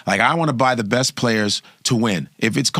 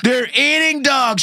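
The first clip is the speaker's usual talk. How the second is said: Trump impression; 11 semitones higher, slower than usual. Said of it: "I've never seen Kamala look blacker".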